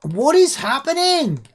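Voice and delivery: high, loud startled yell; surprised shout. Real, not acted. Streamer yelling at his glitching snowball game, shocked.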